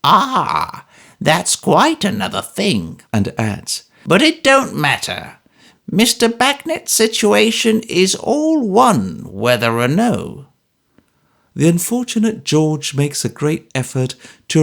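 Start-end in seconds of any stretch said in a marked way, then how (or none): 0.0-3.0 high, slow, creaky-old-man voice put on over fake pleasantness; an old miser pretending to be agreeable; old Smallweed voice, high and slow, pretending good humour; cranky old man, mock good temper. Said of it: "replies with excellent temper". 4.0-10.5 put-on high, slow, crabbed old man's voice; spiteful old miser. Narrator voices old Smallweed.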